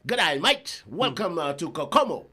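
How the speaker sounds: hammed-up Australian welcome; Australian accent. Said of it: "Wa, wa Australia ni".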